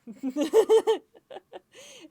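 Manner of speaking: very loud, high-pitched cackle; laughing fit. Real, not acted. Cracks up again.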